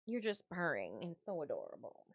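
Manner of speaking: normal talking voice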